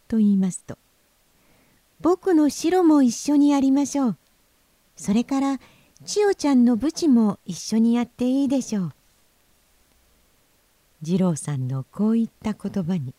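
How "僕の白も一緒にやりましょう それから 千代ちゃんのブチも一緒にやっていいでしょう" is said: high, loud little-brother voice; voiced young boy, high and forceful; younger brother. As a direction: propose it eagerly to big brother, pitched up. 次郎さん proposes more fish.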